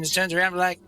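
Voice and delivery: normal talking voice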